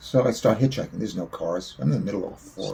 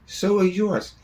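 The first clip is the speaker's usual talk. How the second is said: retort; 12 semitones higher, 8 dB louder, slower than usual. Retold or imitated. Father's retort about bleeding heads.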